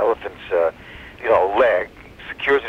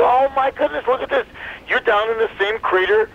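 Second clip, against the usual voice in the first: higher-pitched mock astonishment; acted amazement, pitched up; astonished; 13 semitones higher than usual. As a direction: exclaim in surprise at a discovery. Elephant discovers monkey in crater.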